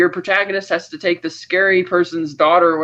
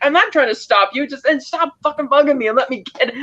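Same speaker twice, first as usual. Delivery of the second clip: higher-pitched mock bickering; retold yelled argument; 7 semitones higher than usual. Retelling two men fighting over adrenaline shot instructions, mock yelling. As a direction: act out both sides of a squabble, pitch raised. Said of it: "yelling at each other about it".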